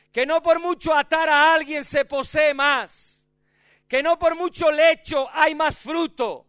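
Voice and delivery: juramento gritado, agudo y fuerte; la novia suplica a gritos a las doncellas. Acted. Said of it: "¿Por qué está gritando así esa muchacha?". (la novia conjura a las doncellas a gritos)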